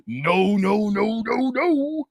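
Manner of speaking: ordinary delivery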